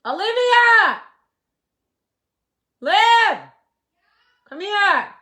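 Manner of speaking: loud high shout across the house; shouting for the daughter to come over; calling out. Real, not acted. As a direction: yell for her to come. Calling daughter, louder each time.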